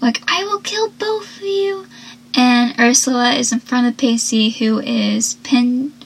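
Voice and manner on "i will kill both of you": high, softened imitation of screaming; mock scream. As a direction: mimic screaming threats without the volume. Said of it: "banging and screaming at the door".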